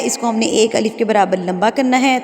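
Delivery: normal talking voice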